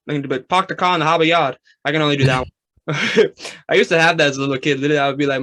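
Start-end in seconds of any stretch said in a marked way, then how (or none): 0.5-1.6 Boston accent with dropped R's, "Pahk the cah". Shown as a demonstration. Showing the interviewer a Boston accent. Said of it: "I can only do the R's".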